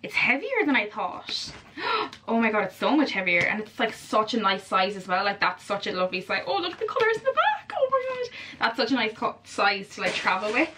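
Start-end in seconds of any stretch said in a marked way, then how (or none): 6.5-8.3 very high excited squeal; thrilled gasp of admiration, pitched way up; delighted excitement. Real, not acted. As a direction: squeak with delight at a lovely find. Delighted at new palette's colors.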